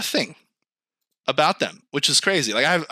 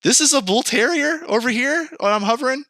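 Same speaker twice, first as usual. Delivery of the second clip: incredulous; 10 semitones higher than usual. Retold or imitated. Shocked reaction to breed photos.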